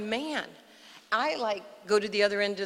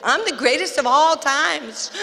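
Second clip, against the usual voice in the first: loud, higher outburst of bravado; mock champion boast; 4 semitones higher, 7 dB louder than usual. Girl suddenly boasting to her idol, loud mock bravado. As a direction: burst out proclaiming it like a boxing champ.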